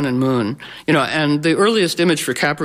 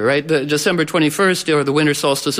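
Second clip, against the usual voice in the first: ordinary talk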